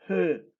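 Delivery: "He" with an audible puff of h; aspirated E. Shown as a demonstration. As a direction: show the class the aspirated "He". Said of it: "es como que se aspira".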